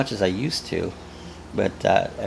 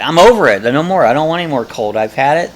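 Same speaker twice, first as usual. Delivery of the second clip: louder, exasperated I'm-done voice; fed up; 4 semitones higher, 7 dB louder than usual. Retold or imitated. Viewer fed up with winter, mock exasperated. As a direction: play someone sick of the cold. Voicing someone fed up with cold.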